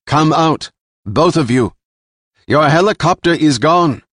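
loud, high summons to hidden foes; commanding villain. Acted. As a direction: call them out like a ruthless boss. Jilan orders the Fargos out.

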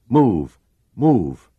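high loud repeated yell. A narrator's reading. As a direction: bellow it twice, urging it on. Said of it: "Giordino yelled".

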